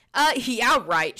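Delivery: loud, scoffing exclamation; exclaimed. A narrator's reading. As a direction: throw it back loudly in disbelief. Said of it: "she exclaimed".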